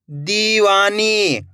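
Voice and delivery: दीवानी very slowly, दी and नी held; long ई. Shown as a demonstration. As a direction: stretch both ee sounds in दीवानी. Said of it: "मेरे साथ साथ इनको repeat करना है".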